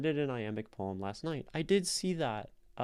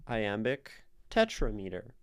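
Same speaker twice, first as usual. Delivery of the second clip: usual speaking voice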